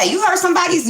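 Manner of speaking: ordinary talk, nothing marked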